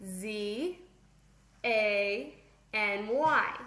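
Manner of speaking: letter by letter, with pauses; measured classroom spelling. Shown as a demonstration. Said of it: "And you spell it".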